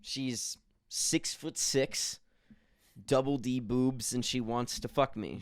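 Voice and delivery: slow, deliberate listing; slow boast. Shown as a demonstration. Host slowly describing his imaginary ghost lover. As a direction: spell it out slowly like a proud announcement. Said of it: "first take, slow boast".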